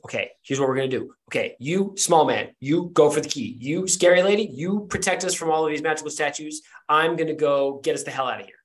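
rapid-fire words, barely pausing; breakneck fast talk. Acted. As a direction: rattle it all off at breakneck speed. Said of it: "start speaking at, like, a breakneck clip".

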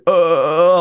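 high, drawn-out dumbfounded "uhhh"; imitated groan of someone lost for words; stumped "uh". Imitated dad, challenged by a coach, stumped. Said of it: "I didn't know what to say".

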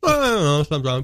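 talking with a mouthful; eating while talking; mouth full. Real, not acted. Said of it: "Sorry, I'm eating".

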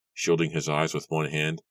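plain reading voice

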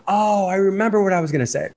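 acted-out moment of recall; sudden recollection. Acting out a sudden middle-of-night recollection.

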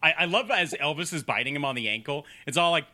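usual speaking voice

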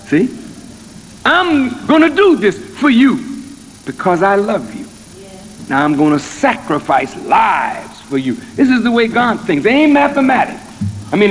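full-force preaching. Acted. Minister voicing God's plan to the congregation, rising preaching.

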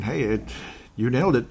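ordinary talk

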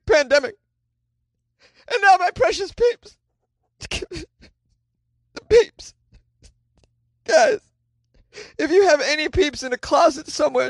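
high, whiny mock-tragic lament; mock sobbing whine. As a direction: wail like the world is ending, hammed up. Whining over lost pumpkin Peeps.

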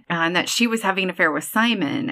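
ordinary talk, nothing marked

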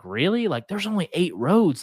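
surprised. Retold or imitated. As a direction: recall the shock at the numbers, rising. Asking biologist about hunter numbers.

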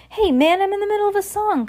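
high, fast annoyed protest; complaining. Acted. Singer complaining to Max mid-song, annoyed. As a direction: whine about being interrupted. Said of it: "complained the singer".

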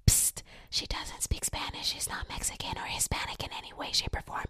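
hushed, unvoiced whisper; whispered aside. Friend covertly explaining to offended grandparents, whispered. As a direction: whisper it like a secret.

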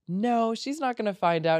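high, breezy dismissal; breezy denial. Retold or imitated. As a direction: brush the worry off lightly. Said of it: "And I'm like".

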